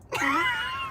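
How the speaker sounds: high squeaky laugh; a shrill little cackle; squealing laugh. Real, not acted. Laughs at own retort.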